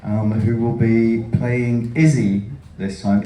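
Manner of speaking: normal talking voice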